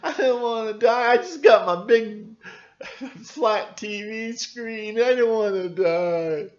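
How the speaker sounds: high, loud whiny mock panic; hammed-up terrified kid; mocking panicked youth. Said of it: "the youth".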